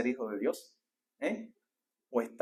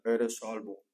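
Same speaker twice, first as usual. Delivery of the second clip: imagined divine voice; 5 semitones lower, slower than usual. Retold or imitated. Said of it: "que una voz te diga".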